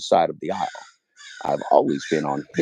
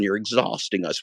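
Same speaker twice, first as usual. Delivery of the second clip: ordinary talk, nothing marked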